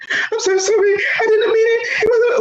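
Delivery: very high, wailing imitation of tears; mock crying. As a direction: squeak out a tearful apology, hammed up. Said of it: "So she started crying".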